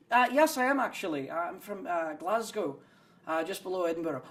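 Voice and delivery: imitated lilting Scottish voice; Scottish accent. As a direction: answer as a Glasgow local, hesitating. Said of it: "Are you from Scotland?".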